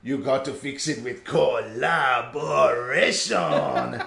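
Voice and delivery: high, slow, drawn-out guru pronouncement; over-the-top Doctor Hakim. Retold or imitated. Quoting the game's doctor to her co-host, slow hammed-up guru voice. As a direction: stretch it out like a smug self-help doctor. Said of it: "like, way over the top".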